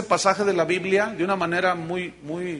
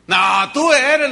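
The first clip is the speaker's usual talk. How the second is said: louder, slow insistent accusation; 5 semitones higher, 7 dB louder, much slower than usual. Acted. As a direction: press the accusation hard. Woman insists second time.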